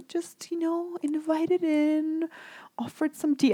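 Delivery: attempted British accent. Quoting a favorite Buddhist monk's advice, attempted British accent. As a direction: try a British accent, high and soft. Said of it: "he says it in a British accent".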